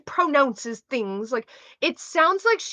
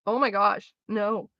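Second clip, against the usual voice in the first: normal talking voice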